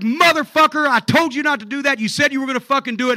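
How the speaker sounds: high-pitched, loud mock tirade; angry wife. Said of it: "she's hot".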